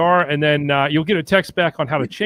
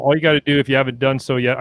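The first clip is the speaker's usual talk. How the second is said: normal talking voice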